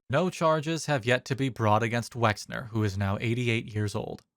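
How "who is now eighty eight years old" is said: normal talking voice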